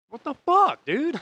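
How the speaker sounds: high, loud "what the fuck, dude?" in exasperated disbelief; disbelieving outcry. Retold or imitated. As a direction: throw it up in frustrated disbelief. Stranded, train goes on strike.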